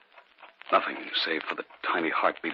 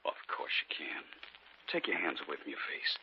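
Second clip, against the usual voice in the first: softer, whispered coaxing; soothing whisper; 4 semitones lower, 9 dB quieter than usual. Acted. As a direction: gently whisper to calm a scared wife. Husband coaxes frightened wife.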